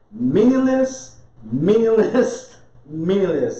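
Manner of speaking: slow, stretched meaningless repeated; mock-solemn slow refrain; drawn-out preacher repeat. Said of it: "Solomon wrote you a book and told you".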